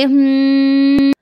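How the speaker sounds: long, drawn-out thinking hum; stretched hmm before answering her husband; thoughtful hmm. Retold or imitated. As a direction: hum slowly while deciding. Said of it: "जरा विचार करायला लागते".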